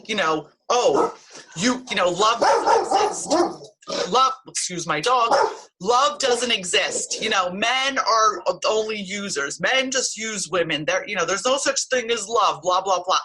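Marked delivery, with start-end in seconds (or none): 0.7-4.3 louder, cynical parent lecturing about love; bitter parent. Retold or imitated. Parent telling a child love is fake, louder and cynical. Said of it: "I was told". 5.8-13.2 louder, cynical parent lecturing about love; bitter parent. Retold or imitated. Parent telling a child love is fake, louder and cynical. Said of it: "I was told".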